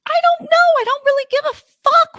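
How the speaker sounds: incredulous indignant fury; outraged. Real, not acted. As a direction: spit it out in outrage, voice shooting up. Furious about man kissing daughter.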